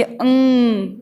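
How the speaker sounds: अंग drawn out very slowly; slow sound demo. Shown as a demonstration. Teacher sounds out अंग slowly for the symbol. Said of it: "अंग का symbol है".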